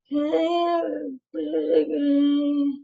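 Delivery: high, drawn-out, soft whine; whiny kid. Retold or imitated. Kid pesters parent for a treat, whiny. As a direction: whine it slowly like a pleading little kid. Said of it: "make this weird noise".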